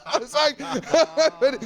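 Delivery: high, helpless laughter over the words; laughing. Real, not acted. Guest cracking up at his own story. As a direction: lose it laughing all over again.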